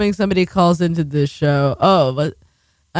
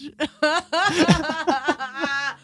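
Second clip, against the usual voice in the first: high laugh; 8 semitones higher, 5 dB quieter than usual. Real, not acted. Laughing at own bit.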